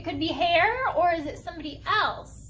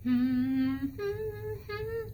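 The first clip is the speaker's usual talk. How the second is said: humming; 4 semitones higher, much slower than usual. Shown as a demonstration. Leader shows children humming with a friend, tuneful hum. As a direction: hum a simple tune with closed lips. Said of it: "You can hum with a friend".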